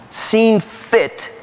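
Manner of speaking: ordinary talk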